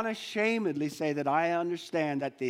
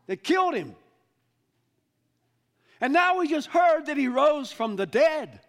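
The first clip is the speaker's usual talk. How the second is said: higher and louder, bursting with the news; excited disciples; 9 semitones higher, 6 dB louder than usual. Acted. Disciples tell the stranger their news, excited and loud. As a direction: blurt out the unbelievable news. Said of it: "I can almost hear this now".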